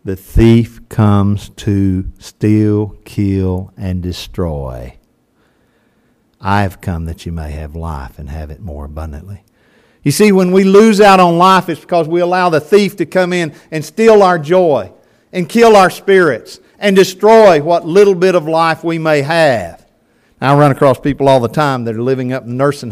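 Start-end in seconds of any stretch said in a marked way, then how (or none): 0.1-9.4 very slow and low, each word weighed; slow scripture reading. Acted. Pastor reads John to congregation, very slow and low. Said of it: "Hear it". 10.0-19.8 louder preaching push. Acted. Pastor urges congregation, preaching with force.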